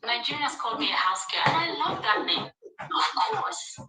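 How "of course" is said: high, airy sassy of course. Real, not acted. Proudly accepting the house girl name, high sassy tone. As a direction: toss it off with pride. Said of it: "proud of the name".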